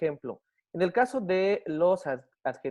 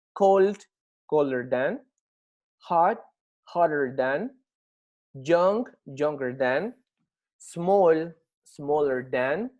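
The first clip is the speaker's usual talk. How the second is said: loud base word, then slowly stretched comparative; measured teacher drill, pausing between items; word-list drill; 4 dB louder, much slower than usual. Shown as a demonstration. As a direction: model each pair for a class, deliberately. Models word list for students.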